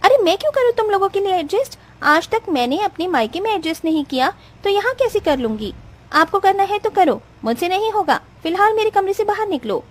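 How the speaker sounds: high, angry scolding of the mother-in-law; furious bride. Acted. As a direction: wake her up in a rage.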